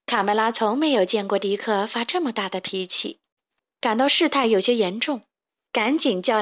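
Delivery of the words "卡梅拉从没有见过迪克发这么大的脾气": ordinary delivery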